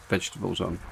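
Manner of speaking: ordinary delivery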